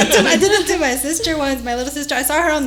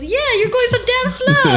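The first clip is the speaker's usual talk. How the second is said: much higher, mock-exasperated; retold complaint; 11 semitones higher than usual. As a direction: imitate an exasperated friend, pitched up. Retells friend's complaint about driving.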